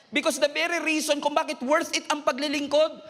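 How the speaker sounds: rising fervent shouts of encouragement; preaching at full force. Acted. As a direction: preach it hard, lifting the room. Preacher builds to climax.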